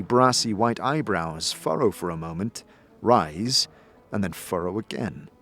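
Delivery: ordinary delivery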